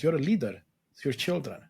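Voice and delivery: usual speaking voice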